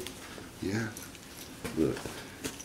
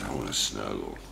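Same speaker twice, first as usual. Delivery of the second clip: voicing the monkey; 11 semitones lower, 9 dB quieter than usual. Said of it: "[name] says".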